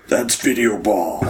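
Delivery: slow, put-on superhero announcer voice; stretched-out imitation of a game announcer; Batman. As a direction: draw it out in a mock superhero voice. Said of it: "Like Batman".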